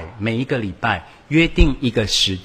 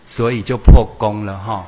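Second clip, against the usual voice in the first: ordinary delivery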